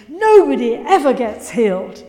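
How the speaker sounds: loud, furious denial; angry retort. Retold or imitated. Nurse rejecting healing, shouting angrily. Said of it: "she rose up in her anger and her rage".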